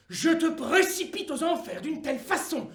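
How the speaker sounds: crie en riant, de plus en plus fort; menace hilare. Acted. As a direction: menace triomphante qui explose en cri. Le diable menace Dieu, rire. (menace en riant, jusqu'au cri)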